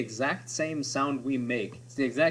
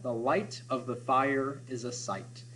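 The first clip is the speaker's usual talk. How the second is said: slow and softer, long I stretched in "light", "fire", "sight"; 6 dB quieter, slower than usual. Shown as a demonstration. Teacher showing how the long vowels slow the reading, slow and soft. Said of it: "forcing us to slow down".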